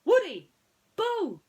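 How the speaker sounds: high, loud yelp of a name; startled shout. Acted. Ham jumps out at Woody, loud high yell. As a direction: burst out to spook him.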